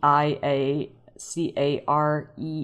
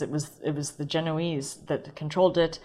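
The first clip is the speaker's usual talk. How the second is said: normal talking voice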